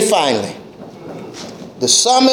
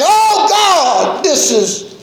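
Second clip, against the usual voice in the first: higher, pleading cry; crying out to God; 3 semitones higher, much slower than usual. Acted. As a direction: call out to God in desperation. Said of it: "He's crying".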